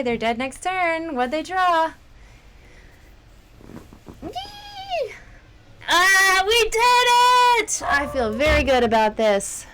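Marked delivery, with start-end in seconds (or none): 4.2-9.7 high loud whoop of joy; victory cheer. Real, not acted. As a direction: cheer the win. Winning the game.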